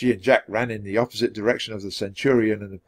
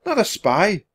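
put-on high child's protest; protesting girl; 10 semitones higher than usual. A narrator's reading. Annie correcting the centurion, protesting. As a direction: object in a small high voice.

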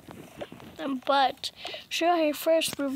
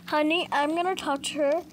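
usual speaking voice

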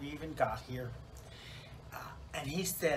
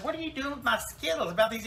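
high, raised-voice demand; acted indignation; 10 semitones higher, 5 dB louder, faster than usual. Newcomer objects to hippie tossing her Skittles, high mock indignation. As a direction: protest sharply, pitched way up. Scolds hippie for throwing candy.

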